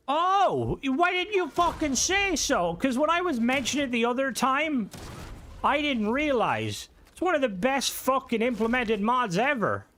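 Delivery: high-pitched, thrilled disbelief; amazed excitement. Real, not acted. Streamer learns viewer made a mod they love, amazed. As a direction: gush in amazement, pitch soaring.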